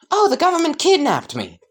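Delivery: high, chirpy character voice; blithe Izuku voice. Acted. Izuku explains where he has been, high and blithe. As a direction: toss it off cheerfully in a high voice.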